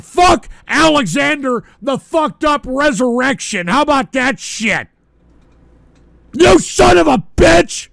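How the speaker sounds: angry shout. Real, not acted. Streamer cursing out the donor by name, building to an angry shout. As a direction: curse the donor out at full volume.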